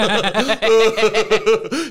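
loud, high imitated wail of strain; exaggerated high panicked noise; mock despairing dad. Imitating the dad struggling with plates, hammed-up despair. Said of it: "And the dad's like".

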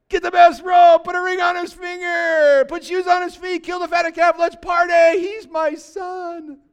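high, full-voice celebratory shout; a father's ecstatic cry to start the party; jubilant shout. Acted. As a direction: shout it out as an overjoyed father, stretching the last words. Father celebrating returned son, preached.

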